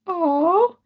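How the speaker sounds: put-on high, disappointed little-girl "aw"; a pouting five-year-old, voiced high; child's aw. Retold daughter deflating at her mother's praise, high and pouty. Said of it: "And she went".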